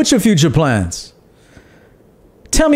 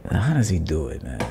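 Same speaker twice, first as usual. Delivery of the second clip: imitating a hater; 11 semitones lower, 10 dB quieter than usual. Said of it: "The mind of a hater".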